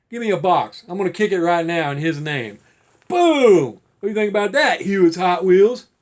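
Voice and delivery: imagined careless shipper yelling a taunt; mock rough handler. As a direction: kick the box and taunt him. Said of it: "That's what they'll do".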